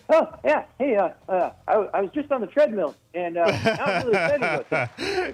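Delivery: a little out of breath, voice pitched high; excited host panting as if just off a treadmill; Jimmy Fallon. Shown as a demonstration. Comedian showing how to do the impression, breathless and high. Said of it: "just be a little out of breath".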